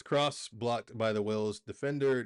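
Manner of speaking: usual speaking voice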